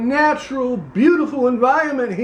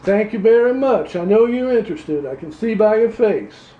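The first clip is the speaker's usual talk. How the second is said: put-on Louisiana accent; 2 semitones lower, much faster than usual. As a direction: play a pompous Louisiana candidate, Southern accent. Same character continues.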